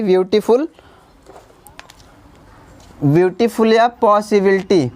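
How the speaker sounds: usual speaking voice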